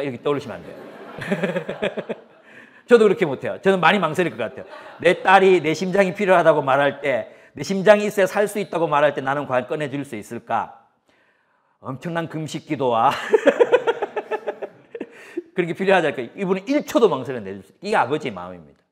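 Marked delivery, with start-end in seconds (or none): 0.8-2.1 short real chuckle, rising; a real laugh with the congregation; amused laugh. Real, not acted. Laughs at own joke. 13.2-14.7 real high-pitched laugh; amused giggle mid-sermon. Real, not acted. Laughs about fasting prayer.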